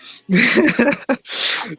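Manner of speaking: real short burst of high laughter; laughing. Real, not acted. Player reacting to the guard's parting jibe, laughing.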